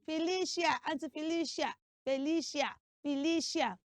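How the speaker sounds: "Felicia" ending in shee-a; common mispronunciation. Shown as a demonstration. Teacher mimicking the popular way, mispronounced "Felicia". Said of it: "that name is not pronounced Felicia".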